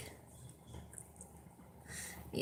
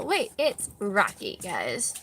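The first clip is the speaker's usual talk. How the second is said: ordinary delivery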